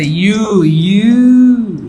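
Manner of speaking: teacher's exaggerated, lengthened "ইউ"; stretched sound demo. Shown as a demonstration. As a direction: hold the yoo sound of "ইউ", swelling on the repeat. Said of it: "ইউর মতো উচ্চারণ হচ্ছে".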